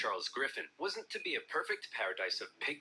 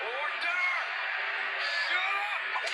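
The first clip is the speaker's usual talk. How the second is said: speaker silencing parliament, much higher and louder; furious shout; 5 dB louder, much slower than usual. Pretend. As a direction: bellow for order over the noise. Parliament speaker yelling at MPs.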